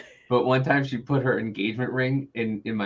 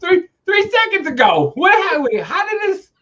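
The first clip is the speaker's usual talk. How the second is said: very high, loud mock disbelief; hammed-up squealing bafflement; bewildered outburst, retold; 8 dB louder than usual. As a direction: sputter it high in utter confusion. Retold bewilderment at mom's switch.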